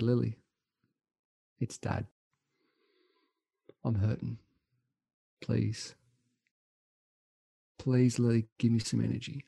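hushed, soft desperate plea; a faint retold prayer to his daughter; pleading to daughter. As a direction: beg her quietly for strength. Retold plea to daughter mid-race.

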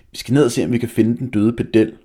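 put-on boy boasting loudly to the group; loud bragging. A narrator's reading. Malte loudly boasting to the boys. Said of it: "med samme høje stemmeføring".

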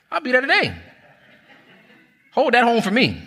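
grab the offer before anyone else does; eager yes. Retold or imitated. Telling the landlord he'll come today, high and eager.

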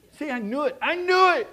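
mock panic, voice jumping high and loud; mock alarm. Preacher playing a nervous churchgoer, mock alarm. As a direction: play the startled churchgoer who fears for his wallet.

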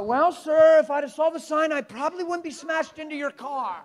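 loud, high, exaggerated retort; mock sarcastic comeback. Preacher plays woman answering the scolding driver, mock sarcasm. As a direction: fire back with hammed-up sarcasm.

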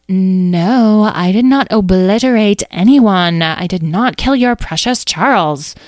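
loud, emphatic retort; indignant denial. Acted. Amethyst denies killing the mouse's friend, indignant. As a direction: push back hard at the accusation.

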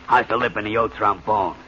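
ordinary delivery

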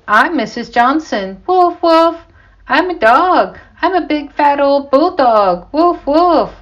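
high, loud teasing chant with woofs; hammed-up mock bulldog act; clowning dog imitation. A narrator's reading. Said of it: "He also kept saying".

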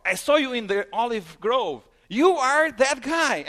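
loud, high accusation; accusing. Retold or imitated. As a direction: point him out loudly. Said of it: "somebody says".

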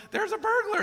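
tiny high-pitched parrot; little parrot voice. Retold or imitated. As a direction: squawk it like a parrot sounding the alarm. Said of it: "that parrot just keeps going".

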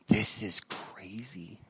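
slow, hushed whisper to oneself; awed whisper. Retold or imitated. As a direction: whisper it slowly in mock amazement. Acting out his awed private reaction.

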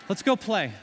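retold boyish burst of cheer; imitated eager child. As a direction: toss it out like a kid off to play. Retells carefree son's sudden cheer.

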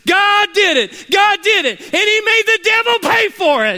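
very high, loud, climbing praise; the woman crowning her praise with a triumphant yell; triumphant shout. Acted. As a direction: peak the shout in victory.

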